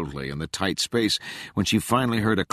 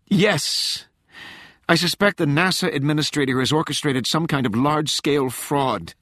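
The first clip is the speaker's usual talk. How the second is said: high, loud, desperate woman; a frantic agent pleading urgently; frantic young woman; 9 semitones higher, 3 dB louder than usual. A narrator's reading.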